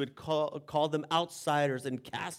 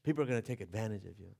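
father's warning, quieter; 6 semitones lower, 8 dB quieter, faster than usual. Retold or imitated. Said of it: "life lesson he tried to teach me".

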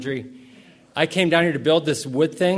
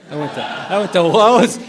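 laughing it out, louder and higher; 5 semitones higher, 10 dB louder than usual. Real, not acted. Pastor telling congregation his shorts story, laughing. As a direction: crack up at your own embarrassment. Recalling embarrassing errands.

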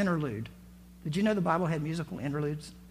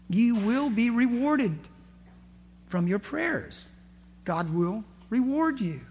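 slow, higher emphatic promise; 4 semitones higher, slower than usual. Real, not acted. Promising the congregation a reward for praying, slow emphatic. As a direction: lean in and promise slowly.

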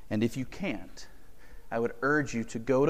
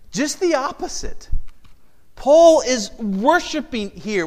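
loud, higher, slow insistence; preaching at full force; 6 semitones higher, 8 dB louder than usual. Acted. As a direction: plead it loudly, slowly.

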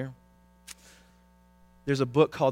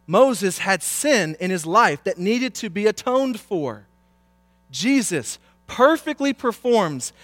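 raised, emphatic scripture proclamation; forceful preaching; 6 semitones higher, 7 dB louder than usual. Real, not acted.

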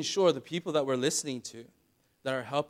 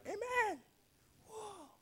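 mock amazed congregation; 11 dB quieter, slower than usual. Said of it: "the church would sort of be like".

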